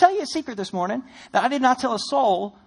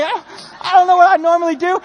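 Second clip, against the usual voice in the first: panicked inner voice; 7 semitones higher, 7 dB louder than usual. Retold or imitated. Panicked inner thought, wedding.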